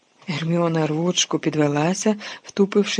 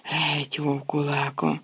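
softer, pained groan; 4 dB quieter, slower than usual. A narrator's reading. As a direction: push it out through pain. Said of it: "Рон простогнав".

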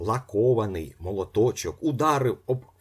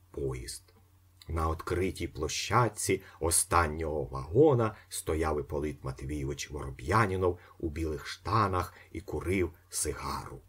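usual speaking voice

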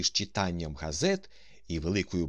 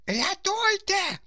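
very high, drawn-out cry for help; loud, slow, piercingly high shout; screaming for help; 6 dB louder, slower than usual. A narrator's reading. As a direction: scream to be rescued. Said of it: "закричав Паніковський".

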